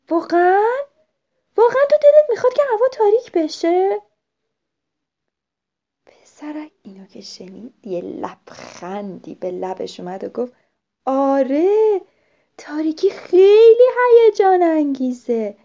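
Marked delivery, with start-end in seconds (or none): 0.1-4.0 high, loud disbelieving question; astonished question. A narrator's reading. Owlet hears boy wishes for darkness, astonished. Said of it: "پلاپ با تعجب گفت". 11.0-15.6 loud, high enthusiasm with swelling stress; excited. A narrator's reading. Boy tells owlet about the dark, loudly excited.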